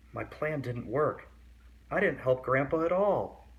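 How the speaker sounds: put-on lively read-aloud for kids; story reading. A narrator's reading. Reading a picture book to kids, lively storytelling. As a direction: read the picture book to young listeners, animated.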